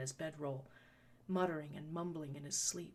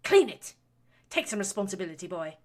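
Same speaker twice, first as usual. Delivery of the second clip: furious order; 3 semitones higher, 12 dB louder than usual. A narrator's reading. Baroness ordering her son to clean her dress. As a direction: snap the order at him.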